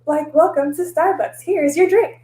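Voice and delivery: high, giddy cheerful hellos; sing-song hyper-happy welcome; bubbly barista greeting. Retold or imitated. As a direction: chirp it like an overexcited barista. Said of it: "So I was always just like".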